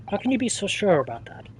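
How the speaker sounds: normal talking voice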